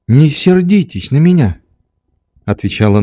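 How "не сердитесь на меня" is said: higher, lightly voiced little Nelly; amused girl, holding back laughter. A narrator's reading. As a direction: plead with a touch of mischief. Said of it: "тщетно стараясь не засмеяться снова".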